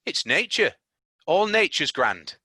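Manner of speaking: lightly voiced country boy, content; a hint of a Yorkshire lad; boy explaining nature to Mary; Dickon's voice.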